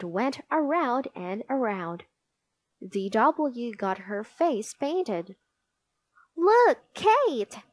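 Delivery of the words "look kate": high, loud, eager call to a little sister; seruan anak. A narrator's reading. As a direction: call out proudly to the baby, pitched up. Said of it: "she said".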